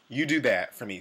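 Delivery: ordinary talk, nothing marked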